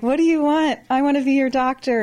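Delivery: friendly neighbor at the door. Retold or imitated. Doctor knocking at a neighbor's door, a lightly voiced friendly neighbor. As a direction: cheerily offer your help like a neighbor knocking. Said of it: "be like".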